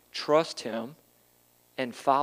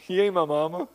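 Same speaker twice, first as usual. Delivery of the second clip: laughing, slangy take of you ain't my mama; imitating Brother Rod; 3 semitones higher than usual. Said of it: "How's Brother Rod say it to Sister Mary?".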